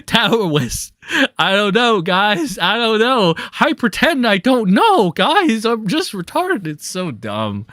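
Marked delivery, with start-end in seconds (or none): 1.4-6.7 higher, louder mock cluelessness; sarcastic mock ignorance. Real, not acted. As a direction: play dumb sarcastically.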